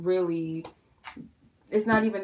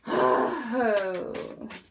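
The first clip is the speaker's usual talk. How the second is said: long, high-ended sigh; exasperated sigh; 5 semitones higher than usual. Real, not acted. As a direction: let out a long despairing sigh. Sighs over the spill.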